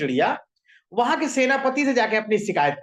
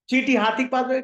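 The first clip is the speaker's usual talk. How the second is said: ordinary delivery, nothing marked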